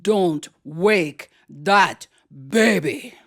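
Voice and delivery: slow, deliberate deep-voiced aunt; Aunt Queenie. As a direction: warn the child, weighing each word. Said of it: "she had a deep, deep voice".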